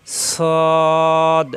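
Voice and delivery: firm, thick model সদ; Arabic letter sad. Shown as a demonstration. Teacher models heavy সদ for the class. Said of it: "সদ যেন না হয়".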